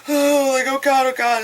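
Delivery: high nervous oh god; a light frightened squeak; mock scared. Nerdy guy facing the girl, mild mock fear. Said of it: "he's like".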